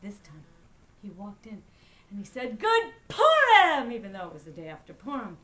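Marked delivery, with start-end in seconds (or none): 2.6-3.9 loud, high, slow greeting; exuberant holiday shout. A narrator's reading. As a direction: burst in calling it out. Pinchas greets store owner.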